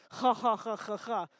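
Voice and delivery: mock society laugh, ha by ha; put-on, evenly spaced posh ha-has; posh fake laugh. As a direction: imitate a haughty sister's laugh. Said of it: "she's exceedingly posh. And she's like".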